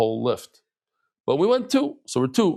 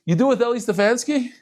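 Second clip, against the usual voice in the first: quoting the skier through a laugh, pitched up; amused disbelief breaking into laughter; laughing question; 9 semitones higher, 3 dB louder than usual. Retold or imitated. Retelling the goy's question on the lift, laughing.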